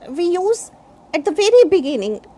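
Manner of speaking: ordinary talk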